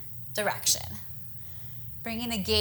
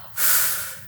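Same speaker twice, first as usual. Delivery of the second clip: breathy whooshing exhale; audible exhale sigh; 4 dB quieter than usual. Shown as a demonstration. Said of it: "exhale out".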